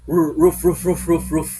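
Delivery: loud barking roof roof like a dog. Shown as a demonstration. Teacher barking like a dog to explain duality, loud. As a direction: bark it out like a big dog. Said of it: "I-dissect natin yung tahol ng aso".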